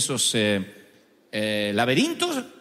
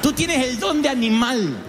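higher-pitched imitated Puerto Rican accent; mimicked Puerto Rican compliment; 4 semitones higher than usual. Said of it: "Cuando fui a Puerto Rico".

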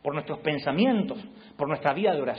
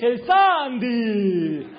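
put-on high, loud, drawn-out childish answer; spoiled teen; 8 semitones higher, 7 dB louder, much slower than usual. Teen son answering his doting mom, whiny and drawn out. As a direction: stretch it out like a pampered kid. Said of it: "¿cuál querés?".

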